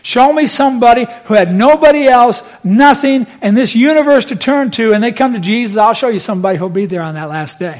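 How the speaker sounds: loud, high preacher's challenge; full-force preaching. Real, not acted. Preacher challenging the congregation to show him somebody, loud preaching. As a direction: preach it out to the back row.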